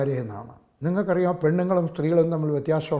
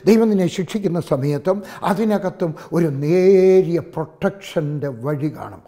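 normal talking voice

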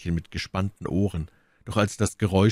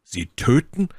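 raised, startled question; horrified exclamation; 7 semitones higher, 6 dB louder than usual. A narrator's reading. Felton grasps why she wants the knife, horrified. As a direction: blurt it with a touch of horror, pitch lifting at the end. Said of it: "rief Felton voll Schrecken".

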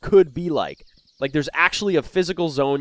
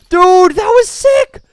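stoked; 14 semitones higher, 10 dB louder, slower than usual. Real, not acted. Reacting to the hit.

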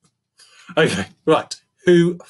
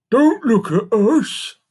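high, loud, slow elephant voice; indignant elephant; 11 semitones higher, 7 dB louder, slower than usual. A narrator's reading. Elephant denying the monkey's accusation, indignant. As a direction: protest slowly in a raised character voice.

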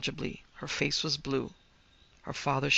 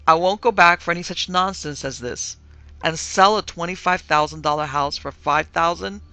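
louder, emphatic refusal; firm; 2 semitones higher, 7 dB louder than usual. A narrator's reading. Husband refusing to sell the house, firm. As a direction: put your foot down, stressing each point. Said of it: "said he firmly".

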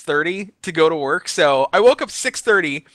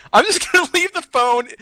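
laughing; 11 semitones higher than usual. Retold or imitated. Giving up, laughing.

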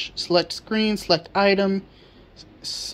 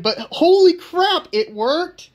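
much higher, louder and slow on holy crap; excited; 10 semitones higher, 5 dB louder, slower than usual. Real, not acted. Reacting to the working board, amazed and thrilled. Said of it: "I'm so excited".

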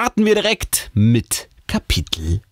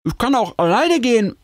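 hoch, mit vollgestopftem Mund gesprochen; an einem großen Bissen vorbeireden; mit vollem Mund. A narrator's reading. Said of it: "sage ich mit vollgestopftem Mund". (mit vollgestopftem Mund)

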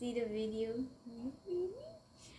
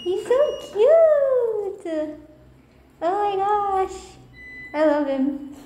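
much higher, louder, very slow squeal of delight; gushing; 8 semitones higher, 14 dB louder, much slower than usual. Real, not acted. Vlogger gushing over a pit bull puppy, delighted squeal. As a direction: melt over the puppy out loud.

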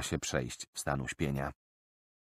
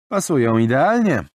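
higher, louder triumphant verdict; satisfied young man; 7 semitones higher, 8 dB louder than usual. Acted. Roland showing the cut purse strings fit, satisfied. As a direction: show the proof plainly.

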